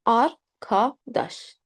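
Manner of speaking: "Arkadaş" very slowly as ar, ka, daş; slow teaching demo of "Arkadaş"; syllable by syllable. Shown as a demonstration. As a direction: break "Arkadaş" into three separate syllables with open a. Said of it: "A always is pronounced".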